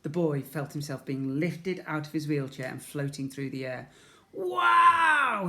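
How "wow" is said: high, loud amazed wow; exclaimed. A narrator's reading. Ned exclaiming in wonder as he flies, high and loud. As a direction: gasp with wonder in the air. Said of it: "he exclaimed".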